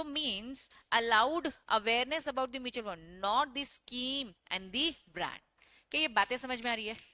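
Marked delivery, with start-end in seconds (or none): 0.0-5.4 शब्द-शब्द धीमे लिखवाओ; बहुत धीमे, साफ़ साफ़ बोलो; slow dictation. Shown as a demonstration. Teacher dictating notes to students. (छात्रों को परिभाषा लिखवाते हुए धीमा स्वर)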